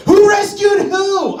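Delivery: high, slow, loud reading of the sign; 1 high mock shout. Shown as a demonstration. Comic reading a bumper sticker, mock shouting it. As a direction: yell the bumper sticker like a slogan.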